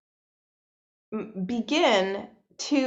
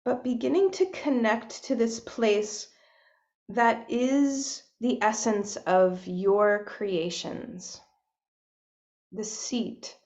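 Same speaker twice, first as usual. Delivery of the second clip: soft, unhurried meditation voice with long pauses; hushed, spacious guidance into breath and body; guided meditation; 4 dB quieter than usual. Real, not acted. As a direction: gently guide listeners inward, leaving space between phrases.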